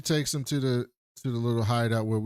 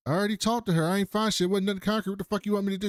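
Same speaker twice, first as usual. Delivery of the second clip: a hint of an irritated cop, pitched higher; 8 semitones higher, much faster than usual. Cop snapping back at his partner, lightly voiced and higher.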